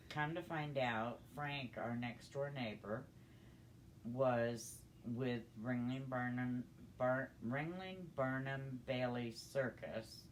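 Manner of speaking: usual speaking voice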